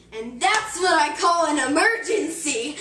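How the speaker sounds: loud, slow, drawn-out final line; dramatic punchline. A narrator's reading. Storyteller delivering the farmer's dying words, loud and slow, laughing. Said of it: "with his last words, he said".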